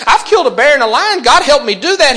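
put-on high, loud boyish boast; proud kid listing his wins; confident young David. Young David telling soldiers his feats, high and loud.

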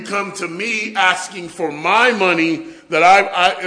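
loud, slow, incredulous father scolding; a stern father, outraged, drawing it out; angry dad lecture. Pretend. Preacher acts his father scolding him for asking for his money. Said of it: "here's what the conversation would go like".

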